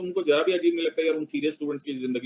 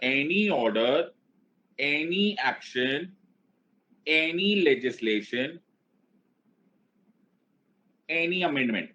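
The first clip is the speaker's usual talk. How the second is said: slow, stretched-out phrases with long gaps; dictation for repeating; much slower than usual. Shown as a demonstration. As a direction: dictate it slowly for a class to chant back. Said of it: "मैं जैसे जैसे बोलता जा रहा हूं उसको बोलना start करो".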